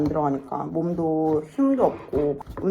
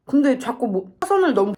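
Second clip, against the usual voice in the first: ordinary delivery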